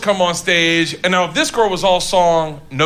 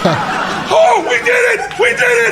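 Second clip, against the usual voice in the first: very high, shouted mock celebration; jubilant cheering; 3 dB louder, slower than usual. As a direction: cheer like mission control after a landing. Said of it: "control room in nasa when Apollo thirteen landed".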